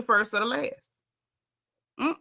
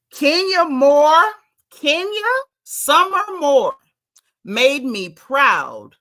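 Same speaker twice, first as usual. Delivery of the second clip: slow, loud, much higher announcing of her full name; proud proclamation; 5 semitones higher, 7 dB louder, much slower than usual. Real, not acted. Host praising Kenya to viewers, loud slow proclamation.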